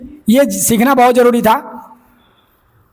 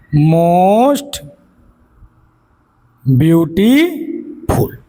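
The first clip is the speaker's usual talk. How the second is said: Most and beautiful each drawn out very slowly, with a long pause between; slow dictation; 4 semitones lower, much slower than usual. Shown as a demonstration. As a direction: stretch most beautiful out for note-takers. Teacher spells out the formed superlative. (Most beautiful को बहुत धीरे, खींचकर बोलना)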